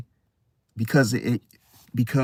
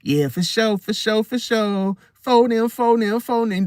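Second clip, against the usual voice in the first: higher, repeated copycat phrases; mimicking someone's slang; 6 semitones higher than usual. Copycat trying to talk like you, mimicking in a higher voice. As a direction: parrot borrowed slang in a raised voice. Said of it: "Trying to talk like you".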